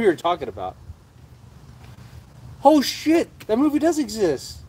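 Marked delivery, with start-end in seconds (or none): 2.6-4.6 high, loud burst of surprised recognition; a shocked oh shit, then disbelief that it exists; sudden surprise. Real, not acted. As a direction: blurt it out like you just remembered. Suddenly remembers the movie.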